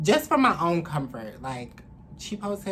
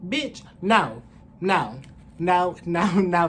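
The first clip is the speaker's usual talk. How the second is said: retold refusal; 2 semitones higher, 8 dB louder, slower than usual. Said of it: "because I'm like".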